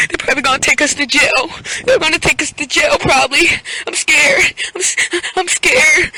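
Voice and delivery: high, sobbing whimper, hammed up; mock crying. Friend fears jail in cop car, mock sobbing. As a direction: imitate a friend blubbering in panic. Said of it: "Typical Blaze is crying, literally crying".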